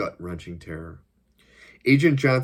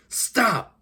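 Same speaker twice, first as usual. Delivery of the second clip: sudden, much higher single shout; shouted command; 4 dB louder than usual. A narrator's reading. Agent halting his henchman, shouted order. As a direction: cry out to halt him at once. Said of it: "he shouted as he pulled his sidearm".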